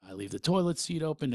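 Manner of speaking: usual speaking voice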